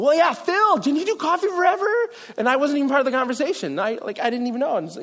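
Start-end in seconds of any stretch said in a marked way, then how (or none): 0.0-1.9 high, louder eager question; a relative suddenly remembering he did coffee; excited family member. Retold or imitated. Relative nudging Phil about coffee, high and eager.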